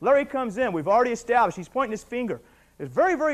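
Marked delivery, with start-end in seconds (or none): none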